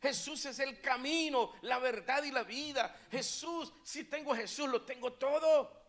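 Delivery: loud, high preacher's proclamation; full-force preaching. Acted. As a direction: belt out the key words to the crowd.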